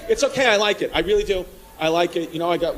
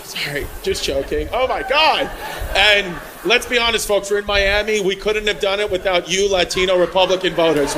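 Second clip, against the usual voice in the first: ordinary talk, nothing marked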